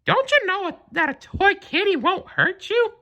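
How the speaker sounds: high falsetto mouse, gently teasing; teasing Mickey. A narrator's reading. Mickey teasing the scared cat, high and gentle.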